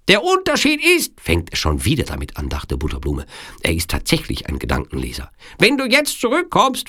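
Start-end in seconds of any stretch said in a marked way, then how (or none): 0.1-1.2 very high-pitched villain breaking in; Sicilian villain. Acted. Sicilian breaks in on her thought. 5.6-6.9 very high-pitched villain making an offer; Sicilian villain. A narrator's reading. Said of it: "fuhr der Sizilianer fort".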